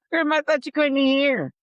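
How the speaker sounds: high, loud mock protest; calling out. Grandchild to grandma who claimed deafness, loud high accusation. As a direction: call it across the room, high and loud.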